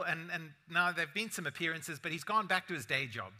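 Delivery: usual speaking voice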